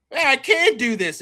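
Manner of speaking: loud, high, psyched-up declaration; gleeful loud vow; pumped-up yes. Acted. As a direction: hype yourself up. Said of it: "And he's like".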